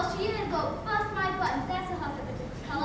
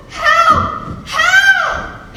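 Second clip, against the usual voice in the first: shrill high scream for help; 9 semitones higher, 15 dB louder than usual. Acted. Trapped girl screams for help, panicked loud scream.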